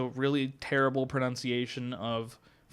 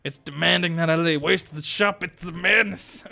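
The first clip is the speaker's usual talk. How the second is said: rising, louder mock desperation; hammed-up madness; 5 semitones higher, 6 dB louder than usual. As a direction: build to an overacted raving cry. Acted raving about the smell.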